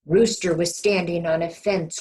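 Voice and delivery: ordinary delivery, nothing marked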